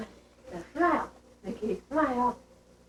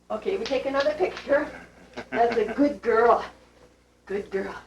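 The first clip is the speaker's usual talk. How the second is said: normal talking voice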